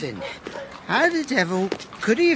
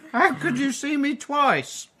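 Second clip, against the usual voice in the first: 1 tipsy puzzlement; 6 semitones higher than usual. Acted. Puzzled drunk father-in-law.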